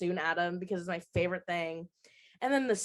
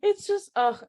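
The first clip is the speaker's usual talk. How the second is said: high emotional laugh; 11 semitones higher, 7 dB louder than usual. Real, not acted. Overcome talking about the show.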